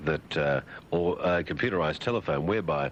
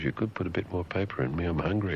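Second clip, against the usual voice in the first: ordinary delivery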